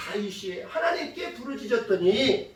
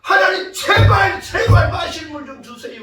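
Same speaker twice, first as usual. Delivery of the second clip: loud crying out; 6 semitones higher, 8 dB louder than usual. Acted. Moses begs God for drinking water, crying out loudly. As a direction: cry out desperately to God, easing off at the end. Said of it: "큰 소리로 부르짖었을 겁니다".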